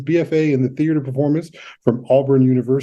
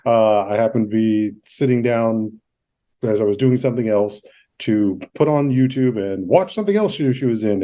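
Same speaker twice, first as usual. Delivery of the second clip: ordinary talk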